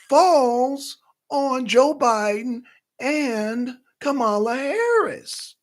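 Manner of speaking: high, slow and deliberate put-on politician voice; Nikki Haley impression. Vlogger keeps mocking the candidate, high and slow. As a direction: lecture slowly like a candidate at a podium. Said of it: "I know it's annoying when I talk like Nikki Haley".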